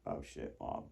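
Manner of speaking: hushed, scared aside from the passenger seat. Retold or imitated. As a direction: whisper it like you're afraid. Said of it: "I'm sitting there scared".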